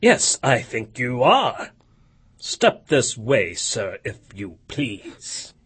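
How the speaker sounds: a stern old boss, put on; feigned gruff Scrooge. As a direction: pretend to scold, mock severity. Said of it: "in his accustomed voice, as near he could feign it".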